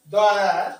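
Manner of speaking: टीएचई said as a short द; the as "duh". Shown as a demonstration. Teacher showing the class the short "the". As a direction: say द with a short neutral vowel. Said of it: "द कहेंगे".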